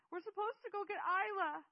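put-on shrieked child's objection, very high; frantic, loud, high-pitched outcry; yelled protest. A narrator's reading. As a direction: yell it at full force. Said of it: "Seth yelled".

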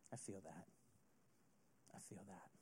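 barely voiced, fading to a whisper; whispered admission. Anyone who has been there, whispering softly. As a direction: say it like a quiet confession to yourself. Listeners echo the feeling.

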